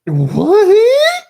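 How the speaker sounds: startled what. Real, not acted. Streamer finds his moderator tools hidden, stunned outburst. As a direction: caught off guard, blurt it out. Sudden change on his computer.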